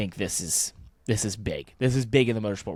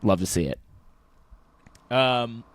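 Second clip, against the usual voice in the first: usual speaking voice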